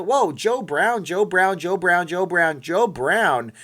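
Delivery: high, loud, sing-song chant of the name; excited sing-song call. Real, not acted. Thrilled streamer loudly greets a donor by name, sing-song.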